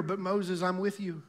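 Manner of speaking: suddenly soft answer from God; gentle reassurance. Acted. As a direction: drop the volume to reassure gently. God answering Moses's protest.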